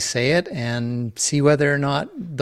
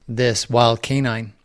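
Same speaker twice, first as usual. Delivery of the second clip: usual speaking voice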